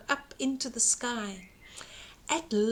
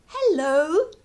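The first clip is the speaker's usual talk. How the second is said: mock shout; 5 semitones higher, 4 dB louder than usual. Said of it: "Jack shouted".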